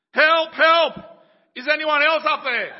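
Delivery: desperate high shout; yelling for help. Acted. Jack, refusing God's advice, shouting for another rescuer. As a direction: scream up for someone else. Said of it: "finally Jack yelled out".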